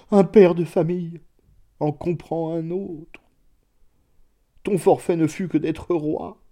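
plainte aiguë et larmoyante; en sanglotant. A narrator's reading. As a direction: pleurer le roi comme un frère. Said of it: "s'écria Redoux en sanglotant". (lamentation sanglotante, aiguë)

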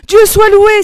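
high, loud cry of relief; relieved, pitch leaping up; joyful exclamation. A narrator's reading. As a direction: cry out in thanks. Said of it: "s'écria-t-elle".

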